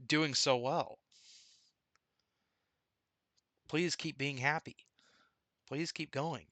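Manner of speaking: soft, gentle brotherly comfort; tender reassurance. Retold or imitated. Dead twin brother gently comforting him, soft and tender.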